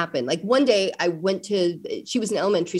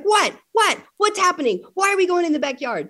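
put-on high, startled child's questions, a bit faster; imitating a bewildered girl, pitched up; mock alarmed child; 10 semitones higher, 3 dB louder than usual. Mother imitating her daughter's alarm at the backyard. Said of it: "She's like".

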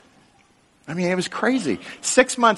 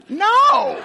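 emphatic no; 5 dB louder than usual. Real, not acted. Denies trying to kill him.

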